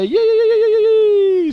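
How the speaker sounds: high, loud yay; celebrating climbing the hill together, high loud cheer; gleeful cheer. Retold or imitated. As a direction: shout it like a thrilled kid. Cheering after success.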